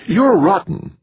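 high, loud female character voice; scolding wife. A narrator's reading. Wife scolds her husband.

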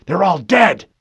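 high, loud panicked cry. A narrator's reading. Harlan crying out that his men are dead, panicked. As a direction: cry out in horror.

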